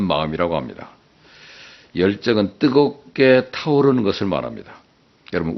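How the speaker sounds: rising pulpit exhortation; impassioned preaching. Acted. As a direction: fire up the congregation.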